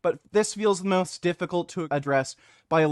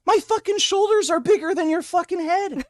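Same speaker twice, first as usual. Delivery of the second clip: mocking voice; 11 semitones higher, 4 dB louder than usual. Mocking the poster's claim.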